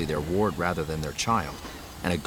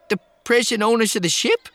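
put-on high, loud nervous young man; 7 dB louder than usual. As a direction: squeak it out like a startled kid. Post voiced, young droid-like.

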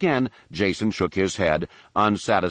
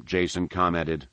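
ordinary delivery, nothing marked

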